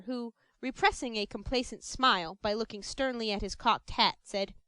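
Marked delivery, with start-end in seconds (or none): none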